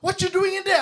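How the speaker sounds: angry producer shouting across the office; shouting man. Retold or imitated. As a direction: bark it at the stranger leaving the room. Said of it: "this person shouts at me".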